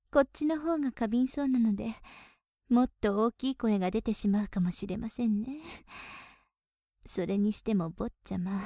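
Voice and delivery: ordinary delivery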